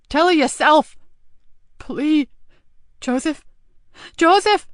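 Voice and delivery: high, loud desperate cry; frantic plea to a dying man; anguished begging. Acted. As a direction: call him back. Nicky begging.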